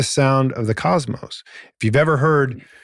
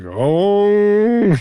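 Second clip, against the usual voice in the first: rising resonant oh; 9 semitones higher, 4 dB louder than usual. Retold or imitated. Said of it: "I'm like".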